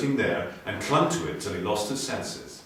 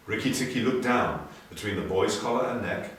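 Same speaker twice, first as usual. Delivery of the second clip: plain reading voice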